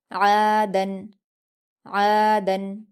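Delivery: throaty Arabic ain in आदन; ain from the throat. Shown as a demonstration. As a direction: open आदन from the throat, clip the tanween. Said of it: "हलक से अदा करेंगे".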